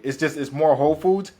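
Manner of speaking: normal talking voice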